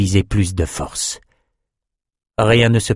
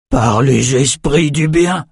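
slow, raised cry of shock; startled exclamation; 5 semitones higher, 3 dB louder, slower than usual. A narrator's reading. Nathan sees the exploded aquarium, high slow exclamation. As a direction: exclaim in stretched-out astonishment. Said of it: "s'écria Nathan".

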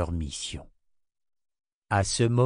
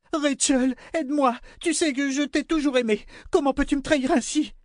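high, desperate queen's voice; pleading queen. A narrator's reading. Queen begging Rachel for help, desperate. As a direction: beg for help.

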